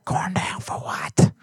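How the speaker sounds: slow whispered hype chant; [name]. Retold or imitated. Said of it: "It kinda sounded like [name]".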